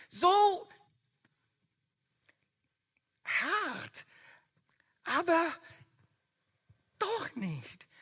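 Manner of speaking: high, loud, stirred up protest that melts into trust; impassioned outburst. Acted. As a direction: flare up, then soften to gratitude. Petrus' gedachte Antwort, erregt. (aufgewühlter, lauter Ausbruch, der in Dank übergeht)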